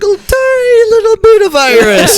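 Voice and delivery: squeaky mock. Real, not acted. Mocking his tiny Irish share.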